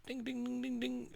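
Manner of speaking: very high, light ding-ding like a tiny bell; bell imitation. Imitating the little bell on the cat's collar, high and tinkly.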